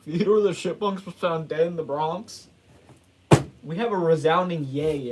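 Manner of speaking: loud joking announcement. Real, not acted. Joke announcement after laugh.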